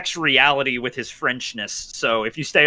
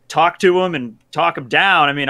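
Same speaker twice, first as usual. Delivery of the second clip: normal talking voice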